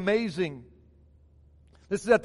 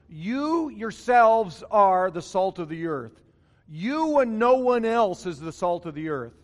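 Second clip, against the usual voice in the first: solemn stressed rendering of the verse; emphatic reading; 5 dB louder than usual. Shown as a demonstration. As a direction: press "You yourselves" and "no one else" hard. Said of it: "it literally reads like this".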